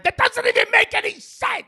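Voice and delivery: incredulous yelling at the clerk; exasperated outburst. Retold or imitated. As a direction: blow up at the absurd rule. Retold outburst at the desk.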